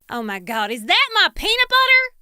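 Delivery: outraged, very high and loud on peanut butter; shocked shout. Retold or imitated. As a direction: shriek in disbelief at the theft. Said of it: "Emily shouted".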